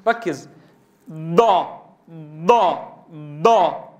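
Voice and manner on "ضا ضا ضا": ضا pressed on the contact zone so it sounds like a heavy dal; wrong dad as emphatic dal. Shown as a demonstration. Teacher showing students the mistaken ض. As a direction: say ضا like a thick د. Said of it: "نطقت دالا مفخمة كما ينطق بعض إخواننا الأتراك".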